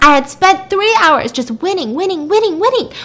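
high, loud exasperated venting; exasperated outburst. Retold or imitated. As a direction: hammer out the repeated word in frustration. Acted outburst over lost chess game.

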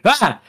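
short, high mock scream; imitated shriek of surprise; startled yelp. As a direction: yelp as if suddenly spooked. Imitating people startled by the doll.

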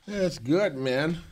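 talking around a pen held in mouth; words pushed past a pen between the teeth. Real, not acted. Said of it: "Got a pen in your mouth".

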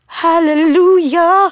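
high, drawn-out cry of praise; mock-heavenly exultation; rapturous hallelujah. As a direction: stretch it out high, like a revelation. Acts out elated reaction to great sex.

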